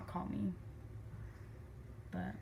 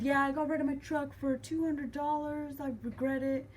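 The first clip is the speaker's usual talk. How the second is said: higher put-on voice for dad; imitated dad; 7 semitones higher than usual. Dad telling daughter of selling truck, imitated. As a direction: mimic his rambling in a raised voice.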